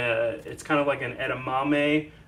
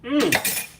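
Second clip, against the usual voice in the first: appreciative mm while tasting; tasting hum; 7 semitones higher than usual. Real, not acted. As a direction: savour it with a long hum. Tasting his own sauce.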